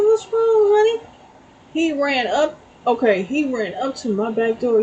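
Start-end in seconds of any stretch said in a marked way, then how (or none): 0.0-1.0 put-on concerned baby talk. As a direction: fret over the little animal up high. Fussing over groundhog.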